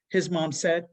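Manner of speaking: ordinary delivery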